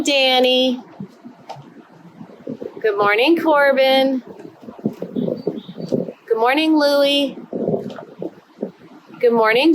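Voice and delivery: bright sing-song roll call; kindergarten teacher voice. Acted. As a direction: greet each little one warmly. Greets each child by name.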